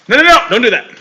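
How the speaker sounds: high, loud alarmed yell; sudden shout. Real, not acted. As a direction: stop someone right now. Yelling at something mid-podcast.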